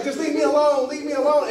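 mock-upset, high-voiced withdrawal; pleading brush-off. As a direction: imitate someone begging to be left. Acting wife withdrawing from argument.

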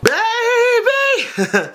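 high, long cried-out word; overcome outburst. Real, not acted. Overwhelmed by the video, high drawn-out cry. Overcome reaction to wedding drama.

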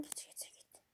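urgent breathless whisper. Real, not acted. Gamer sneaking out, urging himself in a whisper. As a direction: hiss go go go under your breath.

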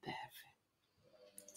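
breathy whisper of praise; soft whispered "perfect". Real, not acted. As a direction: whisper it gently, pleased. Teacher praising student's breath.

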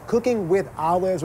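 ordinary talk

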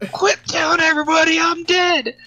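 put-on very high, loud cranky old man shout; Edgar yelling back. Husband Edgar yells at his wife, high cranky shout. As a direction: snap back from offscreen, shrill and loud. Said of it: "one of my favorite scene is that".

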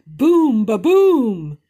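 loud high thunder crash. Acted. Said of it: "crashed the thunder".

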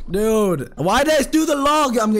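high, loud cry of self-annoyance; exasperated shout at own mistake; frustrated outburst. Real, not acted. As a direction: yell it at yourself in disbelief. Frustrated at own misplay mid-game.

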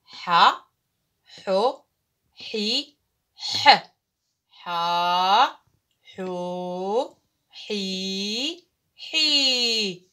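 each syllable sounded out separately, loud; teacher leading a reading drill; reading the letters aloud. Shown as a demonstration. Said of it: "سوف نعيد قراءة الحروف".

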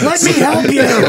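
high, loud, drawn-out mock plea; mock shouted plea. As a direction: holler it slowly as a joke. Acted plea amid group laughter.

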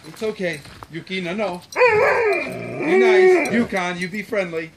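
firm, loud calming commands; steady voice to growling dog. Real, not acted. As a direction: settle the dog down with authority.

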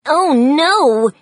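loud, high alarmed cry; sudden yell. A narrator's reading. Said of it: "Petey suddenly yelled".

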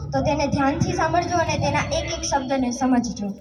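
ordinary talk, nothing marked

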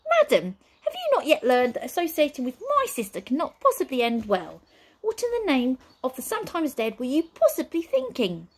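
high, prim, excitable manservant; fussy butler voice. Acted. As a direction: play the jittery butler. Butler scolds Nine.

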